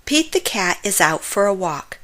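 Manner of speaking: ordinary delivery, nothing marked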